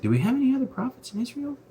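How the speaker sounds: high squeaky king Ahab voice, hammed-up; a silly, high, whiny king asking around; mock king voice. King Ahab voiced in retold story.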